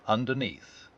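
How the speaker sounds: plain reading voice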